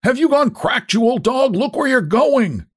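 very high, strained indignant yell; high-pitched outraged holler, stretched at the end; angry street shout. A narrator's reading. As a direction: scold a careless driver from afar.

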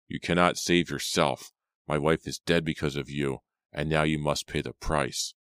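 usual speaking voice

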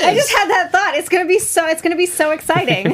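high, giggly and thrilled; bubbling with laughter; laughing excitedly. Real, not acted. Excited laughing over Doctor Who idea.

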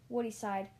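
ordinary delivery

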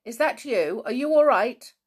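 loud, high, worried call; anxious calling. A narrator's reading. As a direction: call out urgently to check on your child. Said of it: "anxiously calling from somewhere".

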